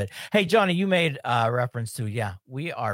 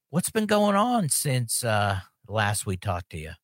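ordinary talk, nothing marked

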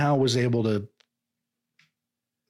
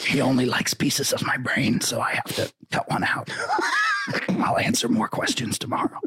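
whispery, strangled politician croak. Acted. Senator wrapping up questions about his worm, strained R F K voice. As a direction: rasp it out with the voice cracking. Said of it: "your R F K impression".

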